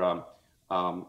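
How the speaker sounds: ordinary delivery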